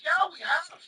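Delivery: high-pitched street seller impression; Chinese vendor. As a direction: mimic the vendor, pitched well up. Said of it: "a Chinese dude eating an apple".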